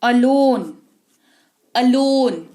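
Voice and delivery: अलोन said clearly with the opening अ sound; pronunciation demo. Shown as a demonstration. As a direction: model अलोन, leaning on the first अ. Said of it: "ए का उच्चारण अ जब होता है". (अलोन का उच्चारण साफ बोलकर)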